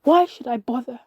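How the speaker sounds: loud, despairing outburst; shouting. A narrator's reading. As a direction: shout it at your own reflection. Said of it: "and I shouted".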